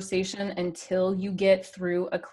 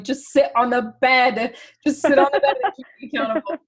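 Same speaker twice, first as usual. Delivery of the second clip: louder, higher, playful begging; exasperated mock plea; 6 semitones higher, 7 dB louder than usual. Coach retelling her plea to Blaine, exasperated and laughing. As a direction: plead loudly, breaking into a laugh.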